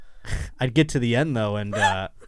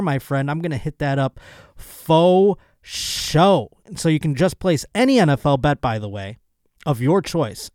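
upbeat sponsor read, loud and a bit higher; 4 semitones higher, 5 dB louder than usual. Acted. Host to podcast listeners, pitching a sponsor in an upbeat read. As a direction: sell the bet like a hyped sports host.

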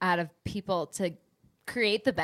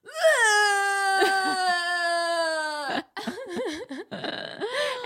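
long high roaring retch on request; dinosaur throw-up noise; 10 semitones higher than usual. Shown as a demonstration. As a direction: roar like a dinosaur throwing up, much higher. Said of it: "Can you do it?".

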